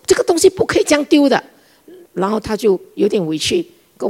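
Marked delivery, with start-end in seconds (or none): 0.1-1.4 higher, louder and fast, laying down the rule; angry scolding. Retold or imitated. Mother-in-law laying down the rule to daughter-in-law, fast and angry. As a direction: snap the rule out quickly. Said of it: "我一下子就火起来了".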